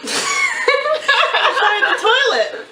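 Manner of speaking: high, helpless giggle through words; laughing. Real, not acted. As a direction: crack up while apologizing. Cracking up mid-video.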